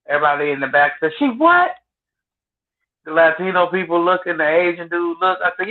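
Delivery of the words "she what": sudden high startled question, a big jump up in pitch; shocked she what. Retold or imitated. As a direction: blurt it out in total disbelief. Coworkers react to live birth.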